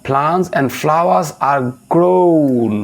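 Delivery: very slow, word by word reading; dictating a definition slowly; slow dictation. Shown as a demonstration. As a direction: read it out slowly for students to copy.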